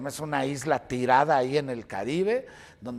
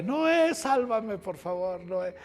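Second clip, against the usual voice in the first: hammed-up cry from behind bars; yelling for help; 6 semitones higher, slower than usual. Jailed reporter gripping bars, yelling to cameraman for rescue. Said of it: "agarrarme de los barrotes y gritarle".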